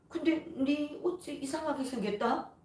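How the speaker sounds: puzzled mother. Retold or imitated. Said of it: "하더라고요".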